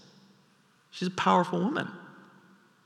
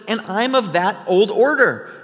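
indignant challenge; 8 semitones higher, 5 dB louder than usual. Retold or imitated. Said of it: "And she says".

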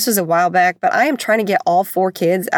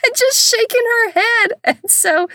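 high, squeaky giggling words; laughing through words; 14 semitones higher than usual. Real, not acted. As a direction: try to talk while cracking up. Laughing while telling.

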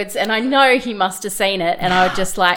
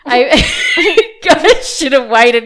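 laughing; 5 semitones higher, 7 dB louder than usual. Real, not acted. Laughing while telling story.

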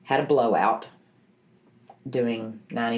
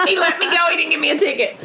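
high, bubbling laugh through the words; 4 dB louder, faster than usual. Real, not acted. To the host about the officer who let her go, laughing. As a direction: laugh with relief, much higher and louder. Relieved laugh ending the story.

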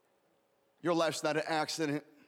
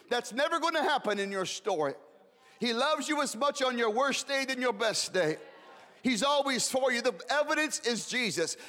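raised, fervent preaching build; rising sermon fervor; preaching at full force; 6 semitones higher than usual. Acted.

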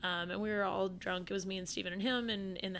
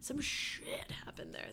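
slow, spooky whisper; ominous whisper; 5 semitones higher, 5 dB quieter, slower than usual. Real, not acted. Repeating about the haunted alley to her friend in a spooky whisper. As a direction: whisper it like a ghost story.

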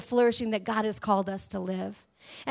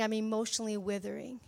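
softer, lower, drooping "I'm emotionally withering"; a hint of mock despair; 3 semitones lower, 7 dB quieter, faster than usual. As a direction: sag it like a worn-out believer. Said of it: "but we're saying".

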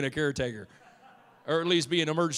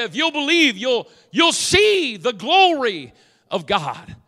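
loud, driving sermon delivery; full-force preaching; 5 semitones higher, 11 dB louder than usual. Acted. Preacher voicing Jesus correcting Martha, full-force preaching. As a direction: preach it loudly, insistently, building to a high peak.

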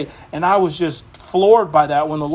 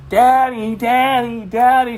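imitating crying toddler; 6 semitones higher, slower than usual. Pastor imitates his crying daughter calling for daddy. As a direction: call out like his little daughter in her crib. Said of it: "She's crying, she's crying".

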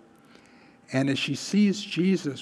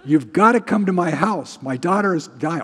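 louder, higher, pressing plea; urgent demand; 4 semitones higher, 5 dB louder than usual. Retold or imitated. Official begging for help in the preacher's version, loud urgency. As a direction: push hard for help right now. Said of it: "this thing would have happened".

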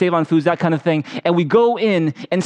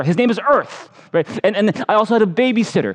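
normal talking voice

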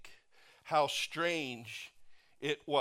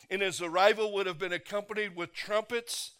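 ordinary delivery

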